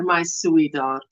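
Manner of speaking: ordinary talk, nothing marked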